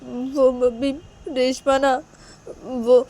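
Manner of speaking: high, halting whine; tearful daughter. Acted. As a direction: falter tearfully while confiding in mother. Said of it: "तुम, तुम रो मत". (रुआँसी बेटी, ऊँची अटकती आवाज़)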